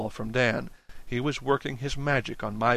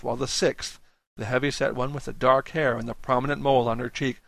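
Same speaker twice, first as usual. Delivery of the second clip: usual speaking voice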